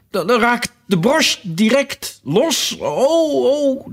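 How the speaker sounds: high, drawn-out wailing into "oh"s; groaned out. A narrator's reading. The queen frets about her brooch coming loose, wailing. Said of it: "kreunde de arme koningin het uit".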